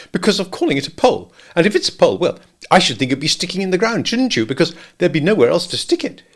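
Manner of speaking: high, bustling Rabbit voice; fussy rabbit, pitched well up. A narrator's reading. Rabbit puzzling over the pole, high and fussy.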